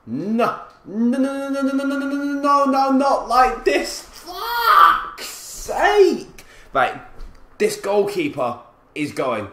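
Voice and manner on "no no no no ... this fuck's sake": high stream of no's building to a scream; anguished wail. Real, not acted. Gamer watching a goal go in, anguished wail. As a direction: wail in panic, ending in a long drawn-out screech.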